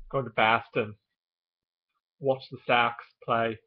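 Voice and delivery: broad Boston vowels; Boston accent. Shown as a demonstration. Vlogger as a local going to the Sox game, Boston accent. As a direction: talk like a Boston sports fan, Boston accent. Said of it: "there's the one I just did".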